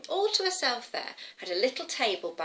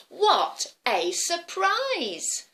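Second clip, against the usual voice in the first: high, very slow astonishment; amazed little girl; 9 semitones higher, 4 dB louder, much slower than usual. Acted. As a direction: stretch out the words in wonder.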